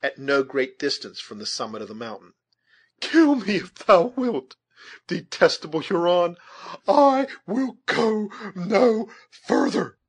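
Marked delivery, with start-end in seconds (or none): 3.0-10.0 high, loud, drawn-out defiance; defiant cry. A narrator's reading. Captive maiden refusing the Huron, crying out in defiance.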